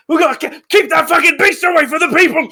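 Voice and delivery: high, loud, fast shouting; alarmed guard. Acted. Guard penning in the emu, alarmed high shout. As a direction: yell urgent orders in a strained high voice. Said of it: "They're like, uh".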